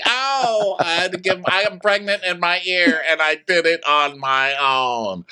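high wailing complaint, drawn out at the end; mock crying. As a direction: wail it like a hammed-up sob story. Mock crying impression of Candace.

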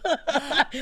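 high, laughing take-back. Real, not acted. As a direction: laugh it off as you speak.

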